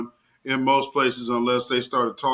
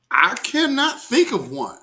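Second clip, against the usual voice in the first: emphatic disbelief; 8 semitones higher than usual. Real, not acted. Marvels at the politician.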